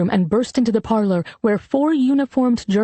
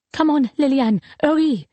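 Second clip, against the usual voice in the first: raised-pitch, pressing plea to hurry; urgent; 5 semitones higher than usual. A narrator's reading. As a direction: hurry a little sister along urgently. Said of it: "she said urgently".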